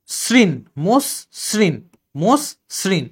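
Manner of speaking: "মোস শ্রীন" as mos, pause, srin; s sound, split. Shown as a demonstration. Teacher spelling by sound, "মোস শ্রীন" split mos - srin. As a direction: separate "মোস" and "শ্রীন", with s not sh. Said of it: "এস এর মতো উচ্চারণ হচ্ছে".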